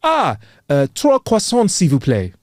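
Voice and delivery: hammed-up French order, high on croissants; mock French accent. Groom ordering in a mock French accent. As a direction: try to pass as a Parisian. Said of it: "sound like a local and replied".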